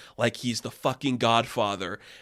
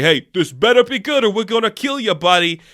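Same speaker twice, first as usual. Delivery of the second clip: mock gangster threat; 6 semitones higher, 5 dB louder than usual. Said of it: "joking at Roddy".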